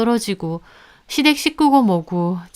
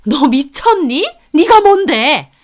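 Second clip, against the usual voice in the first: high, drawn-out indignant shout; outraged, high-pitched outburst; hysterical yelling; 6 semitones higher, 6 dB louder, slower than usual. A narrator's reading. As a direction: yell as if throwing a fit. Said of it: "막 발작하듯 소리를 지르더라고요".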